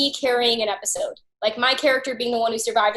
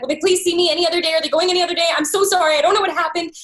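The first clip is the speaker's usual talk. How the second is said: fast, higher, louder begging; frantic plea; 5 semitones higher, 4 dB louder, faster than usual. Retold or imitated. Begging her agent for another audition day, frantic. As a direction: rush out an apology to the agent in a panic. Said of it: "I was like".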